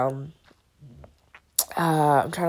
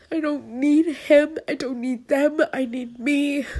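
high, tearful voice, some words louder; crying; 8 semitones higher, 4 dB louder than usual. Real, not acted. Crying to the camera about not needing a father. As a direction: push through the tears. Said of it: "I'm gonna stop crying".

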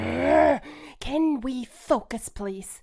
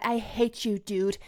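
ordinary delivery

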